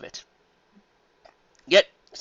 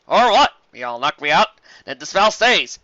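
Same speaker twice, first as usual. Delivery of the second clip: smug dare; 2 semitones higher, 8 dB louder, slower than usual. Acted. Flam calls Carrot Top's bluff, cocky and loud. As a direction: call her bluff.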